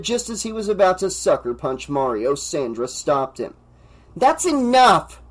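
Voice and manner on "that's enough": high, loud, slow scream. A narrator's reading. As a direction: scream to break it up. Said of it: "she screamed".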